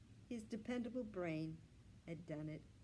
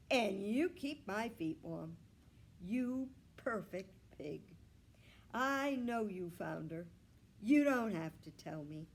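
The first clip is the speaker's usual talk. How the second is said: affectionate; 2 semitones higher, 8 dB louder than usual. A narrator's reading. Grandpa praises his pig.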